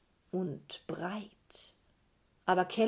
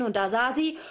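usual speaking voice

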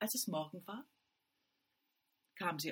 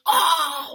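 sharp loud cry of falling; startled cry; 10 semitones higher, 19 dB louder than usual. A narrator's reading. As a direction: yelp as she hits the floor. Sister falls on her bottom.

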